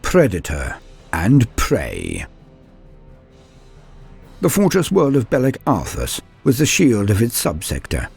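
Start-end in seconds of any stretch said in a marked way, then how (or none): none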